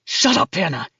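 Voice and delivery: deep, threatening male voice; low menacing man. Acted. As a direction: growl it low like a brute. Max threatens narrator.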